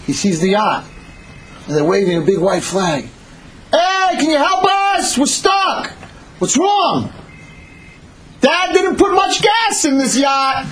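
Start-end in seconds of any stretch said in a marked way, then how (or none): 3.7-7.1 high, stretched hollering; calling across water. Acted. Shouting between boats. 8.4-10.7 high, slow shouted complaint; stranded holler. Acted. Stranded brother yells back.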